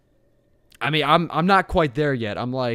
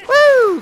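loud, very high-pitched whoop; wild falsetto whoop; 10 dB louder than usual. Real, not acted. Whoops during game combat.

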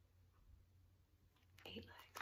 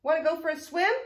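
high, loud storybook duck; duck's invitation; 7 semitones higher, 17 dB louder than usual. A narrator's reading. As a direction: ask eagerly as the duck. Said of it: "called the duck".

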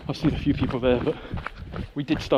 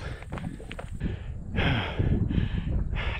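long tired sigh; 3 semitones lower than usual. Real, not acted. Tired runner on hot hill.

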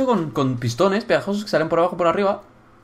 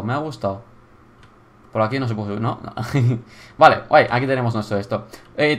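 usual speaking voice

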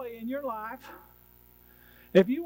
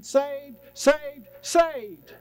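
"saved" repeated, rising higher and louder; building exclamation; 5 semitones higher, 5 dB louder, much slower than usual. Real, not acted. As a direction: build "saved, saved" into a loud, high cry.

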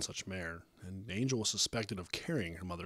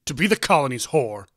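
high, very loud accusation; loud, bitter protest; angry shout; 6 semitones higher, 15 dB louder than usual. A narrator's reading. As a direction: throw it out as an outraged shout. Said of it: "her father shouted".

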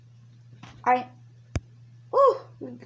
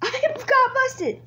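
high, loud, slowed cry of defeat; dismayed shout; 12 semitones higher, 4 dB louder, slower than usual. Real, not acted. Player caught by cops, dismayed high shout. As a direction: wail it out, caught at last.

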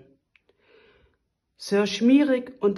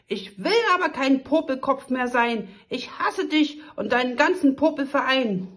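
trotzige Prinzessin; 6 semitones higher, 4 dB louder than usual. Acted.

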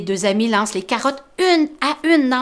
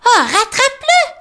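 high, loud urgent mole cry; Tom crying out; 13 semitones higher, 7 dB louder than usual. Acted. Tom urging Grumbo to chase the rabbit, high loud cry. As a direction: call after the runaway rabbit. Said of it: "s'écrie Tom".

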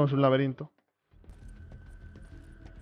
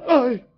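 quick higher startled yelp; startled cry; 7 semitones higher, 5 dB louder than usual. Real, not acted. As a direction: flinch out loud at the scare. Jumpscare in horror game.

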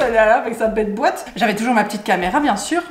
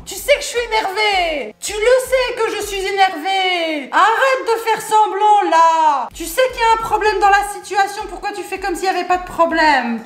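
scolding; 6 semitones higher than usual. Pretend. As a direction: tell them off, stop pretending. Scolding imagined staff. (reproche aigu et lent)

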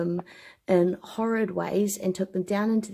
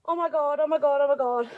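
scared; 5 semitones higher, 4 dB louder than usual. Real, not acted. Scared on ladder near skull.

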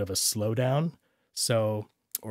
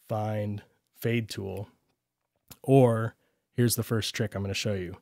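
usual speaking voice

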